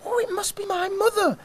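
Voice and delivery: high, fast chick voice; tiny squeaky chick; cheeping chick. Acted. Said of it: "cheeped little chick".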